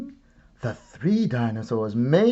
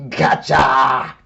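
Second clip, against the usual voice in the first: loud triumphant monster shout; dinosaur yell; 8 dB louder than usual. Acted. Dinosaur in the woods shouting at Goldilocks, loud yell. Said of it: "a dinosaur yelling".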